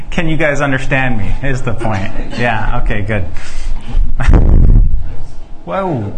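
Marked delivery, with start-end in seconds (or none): 3.3-5.6 nervous laugh. Real, not acted.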